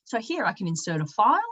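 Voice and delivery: ordinary talk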